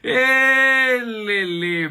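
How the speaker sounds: high loud whoop; cheeky cheer. Acted. Narrator cheering the kiss with a high loud whoop. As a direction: tease him with a whoop.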